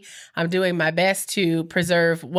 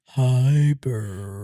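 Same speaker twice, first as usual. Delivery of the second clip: much lower, put-on deep voice; 9 semitones lower than usual. Shown as a demonstration. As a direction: drop the voice way down. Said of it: "you get deep".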